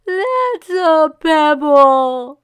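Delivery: high, loud, very slow wail; boy wailing at his father, high, loud and very slow. Acted. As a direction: wail it through sobs. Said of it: "he wailed".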